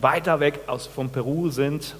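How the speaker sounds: ordinary delivery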